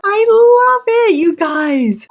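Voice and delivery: high, loud, slow delighted squeal; excited squeal. Real, not acted. Delighted at finished shelf.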